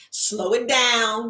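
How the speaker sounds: slow, stretched-out words; slowed-down self-talk. Retold or imitated. Reminding herself not to talk too fast, slowed down. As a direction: coach yourself to ease the pace. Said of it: "I gotta slow it down".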